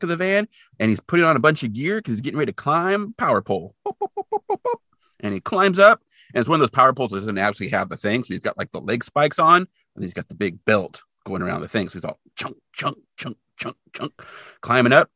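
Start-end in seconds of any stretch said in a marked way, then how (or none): 3.9-4.8 very high little boops; playful beep noises up high; imitated beeping. 11.9-14.1 soft breathy chunk sounds; hushed chunk chunk sound effect; imitated climbing noise. Imitating spikes climbing the pole.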